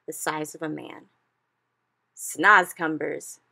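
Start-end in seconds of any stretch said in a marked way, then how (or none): 2.1-3.4 slow, much louder triumphant cry. A narrator's reading. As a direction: draw it out and announce it. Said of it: "he cried".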